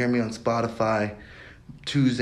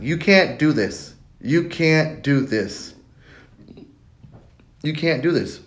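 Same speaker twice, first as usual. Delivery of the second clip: louder, slow, higher chant of doubt; 5 semitones higher, 4 dB louder, slower than usual. Retold or imitated. Inner critic drowning him out, loud and slow. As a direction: hammer it home like a voice that won't quit. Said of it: "it's a loud voice".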